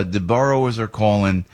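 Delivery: normal talking voice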